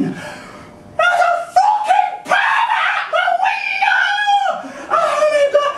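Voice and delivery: high, loud, laughing scream; screaming woman. Retold or imitated. Retelling the woman screaming about the pig, high laughing shriek. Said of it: "I hear her screaming".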